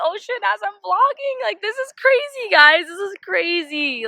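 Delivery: high excited exclaiming. Real, not acted. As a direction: gush about the view. Amazed at ocean view.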